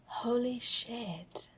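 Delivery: slow, whispered in wonder; awed whisper. Acted. Tony whispering in awe at the code. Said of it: "soft and more than a little awed".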